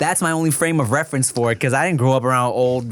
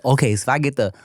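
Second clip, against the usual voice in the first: normal talking voice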